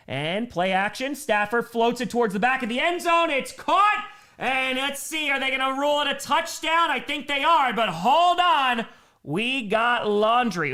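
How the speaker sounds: excited call that climbs high and loud; live play-by-play. Real, not acted. Announcer calls a disputed touchdown catch for viewers, excited play-by-play. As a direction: build to the catch, then stretch out the doubt.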